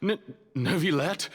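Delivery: startled, stuttering gasp of a name; a frightened prisoner caught off guard; stammering fear. Acted. Cornered prisoner startled by the judge, stammering in fear.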